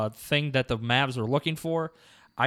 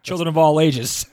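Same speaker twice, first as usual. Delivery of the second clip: loud, higher circus barker line; ringmaster; 3 semitones higher, 8 dB louder than usual. Pretend. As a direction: belt it out to the big top. Joins the showman intro.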